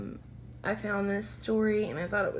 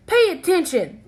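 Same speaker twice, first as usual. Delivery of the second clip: louder, sharper teacher scolding; snapping; 8 semitones higher, 10 dB louder than usual. Acted. Maestro scolds the child in music class, snapping. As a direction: bark it at a daydreaming student. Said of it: "snaps Maestro".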